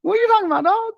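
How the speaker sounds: shrill-high, loud quoted outburst; high-pitched friend impression. Imitating his best friend from Brooklyn, high-pitched and loud. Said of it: "might need to get his voice box checked".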